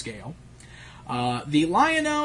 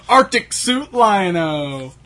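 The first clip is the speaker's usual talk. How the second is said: slow, loud, raised hype voice; mock toy announcer; 5 semitones higher, 6 dB louder, much slower than usual. Riffing on silly toy variants, mock announcer hype. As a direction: draw the name out like a big product reveal.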